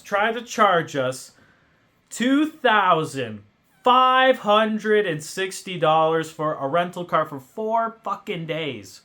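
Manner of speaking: loud, slow, furious rant at the rental company; raging at the rental company's bill; angry rant. Real, not acted. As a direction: spit out each word in outrage. Ranting at rental company overcharge.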